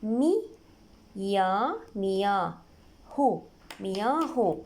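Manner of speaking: syllable by syllable, then joined; spelling out. Shown as a demonstration. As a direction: sound out مياه slowly in separate pieces. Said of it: "نجي نتهجى الموضوع". (تهجئة مقطعية)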